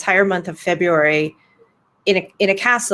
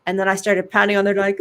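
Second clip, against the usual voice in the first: usual speaking voice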